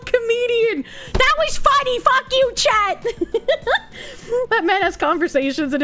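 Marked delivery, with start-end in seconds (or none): 1.1-3.1 high-pitched playful outburst at the chat; mock indignation. 3.1-4.4 short burst of high laughter; amused laughter. Real, not acted. Laughs after scolding chat.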